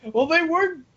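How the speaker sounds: ordinary talk, nothing marked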